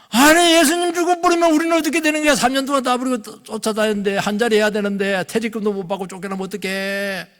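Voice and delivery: high-pitched acted fretting, starting loud and easing; hammed-up high worried whine; panicking disciple. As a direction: mimic a self-interested follower's complaint. 제자의 인간적 걱정을 흉내.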